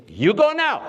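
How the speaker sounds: high-pitched mock command to the models; Patrick de Marchelier imitation. Photographer orders models to resume once the camera's ready, high mock command. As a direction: order them off, pitched far up. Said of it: "and he says".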